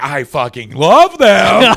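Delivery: loud, drawn-out mock declaration of love; mock passionate declaration. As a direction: belt it out slowly as a joke reveal. Punchline reversing a clickbait clip.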